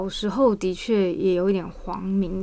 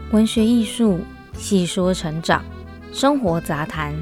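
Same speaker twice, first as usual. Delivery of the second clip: radio host intro. Acted. Podcast intro over music.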